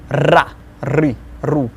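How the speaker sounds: ra, ri, ru with a trilled r; rolled r. Shown as a demonstration. Teacher modelling the trilled Russian R. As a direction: roll the r on each syllable. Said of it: "It's kind of trilling, rolling".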